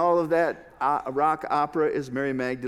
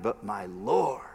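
whispered; 6 semitones lower, 4 dB quieter than usual. Real, not acted. Tender climax on Mary's words.

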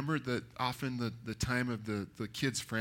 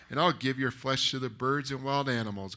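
usual speaking voice

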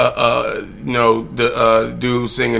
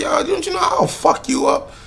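put-on kid's defiant look. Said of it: "keep looking at them like".

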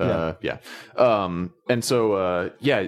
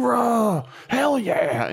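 loud, high hooah Space Marine cheer; loud gung-ho soldier; 14 semitones higher, much slower than usual. Retold or imitated. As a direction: bark it like a pumped-up marine. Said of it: "Space Marines that are like".

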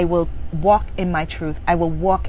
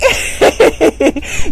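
mocking laugh; 8 semitones higher, 5 dB louder than usual. Taunting the inner critic.

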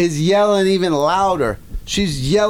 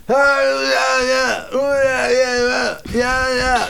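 imitating nonsensical yelling from the front of the store; mock yelling; 8 semitones higher, slower than usual. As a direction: yell nonsense like a rowdy crowd.

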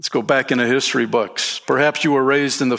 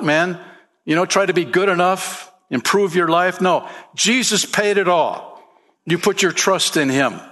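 higher, rising preacher's fervor; fervent preaching; 5 semitones higher than usual. Acted. Preacher urging listeners that Jesus paid it all, fervent preaching. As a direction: preach it with lifted urgency.

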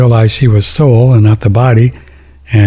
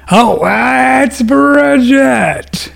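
excited recognition; 12 semitones higher, much slower than usual. Real, not acted. Recognizing caller, drawn out.

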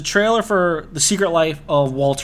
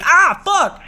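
high-pitched mock cry of pain; retold pained yelp; 14 semitones higher, 4 dB louder than usual. Telling how a friend squeezed his wrist, pained yelp. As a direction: yelp as if your hand is being crushed. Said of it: "And I was like".

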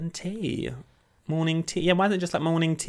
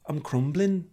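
imitating Cheryl; 5 dB quieter, slower than usual.

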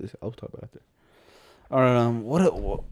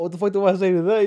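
higher, louder incredulous question; mock baffled outburst; 7 semitones higher, 7 dB louder than usual. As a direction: sound thrown by what you heard. Retold baffled reaction.

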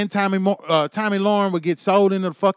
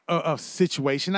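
ordinary talk, nothing marked

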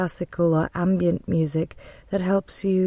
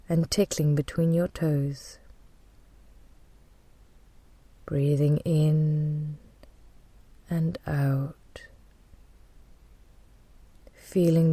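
guided meditation. Real, not acted. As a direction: guide them gently into calm, leaving space to breathe.

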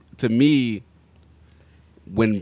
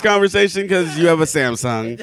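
laughing; 10 semitones higher, 5 dB louder than usual. Real, not acted. Laughing teasing.

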